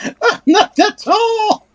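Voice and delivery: high, drawn-out little creature whine; whining drake. Acted. Drake complaining it can't reach, high whine. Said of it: "the Drake just whines".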